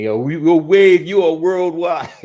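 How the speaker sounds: mock viewer scream, high and loud; shouted sign-off in a put-on voice; imitated scream. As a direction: holler it like a hyped fan in chat. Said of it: "Somebody always screams at the end".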